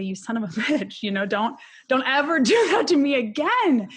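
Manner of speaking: half-laughing rebuke building to an emphatic finish; mock scolding. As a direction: scold a loved one in mock outrage. Imagined scolding of her husband.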